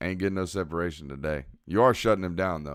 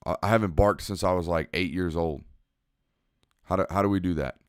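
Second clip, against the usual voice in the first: usual speaking voice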